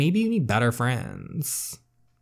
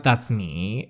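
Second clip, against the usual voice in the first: usual speaking voice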